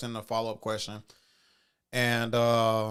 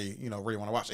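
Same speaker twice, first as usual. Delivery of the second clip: ordinary talk, nothing marked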